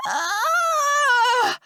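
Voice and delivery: long, high anguished scream; drawn-out high cry; high scream of pain. A narrator's reading. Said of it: "I scream at the top of my lungs".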